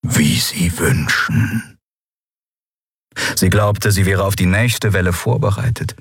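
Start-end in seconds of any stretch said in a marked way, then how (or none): 0.0-1.8 very slow, low villain voice; eerie, drawn-out low reply; sinister captor. A narrator's reading. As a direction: draw it out low and menacingly. Villain grants her taunt.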